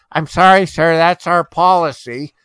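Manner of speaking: hammed-up customer-service voice; a mock polite store clerk; smug clerk. Clerk's smug policy line.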